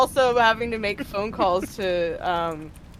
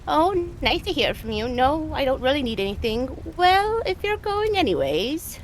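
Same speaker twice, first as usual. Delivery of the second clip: grandmother voice; 6 semitones higher than usual. Acted. Grandmother quoted in story.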